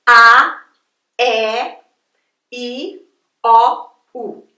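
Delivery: "A", "E", "I", "O", "U" spelt out one by one; Portuguese vowel list, each one separate. Shown as a demonstration. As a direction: recite "A" "E" "I" "O" "U" like a lesson. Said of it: "Você tem as vogais em português".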